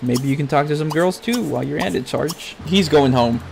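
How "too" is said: ordinary talk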